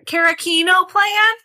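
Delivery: loudspeaker announcement. Retold or imitated. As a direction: call it like a store loudspeaker. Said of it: "Over the loudspeaker".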